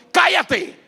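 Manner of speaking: high, loud order to be silent; shouted rebuke. Acted. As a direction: shout it down like the crowd. Crowd silencing the blind man.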